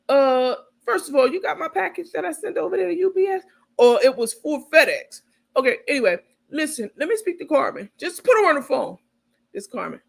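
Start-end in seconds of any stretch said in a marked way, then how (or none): 0.1-9.0 pushy Tay. Retold or imitated. Tay demanding Carmen on phone.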